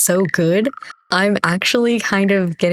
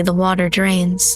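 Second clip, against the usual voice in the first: normal talking voice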